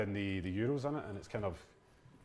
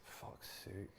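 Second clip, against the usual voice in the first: muttered under breath; 4 semitones lower, 18 dB quieter than usual. Real, not acted. Frustration at a bad pass.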